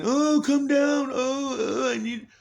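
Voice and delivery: hammed-up imitation of a voice begging him to come down; mock pleading. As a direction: draw out a begging voice way up high. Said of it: "projecting it somewhere else and saying".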